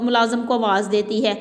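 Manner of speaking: ordinary delivery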